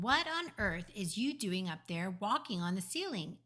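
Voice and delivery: plain reading voice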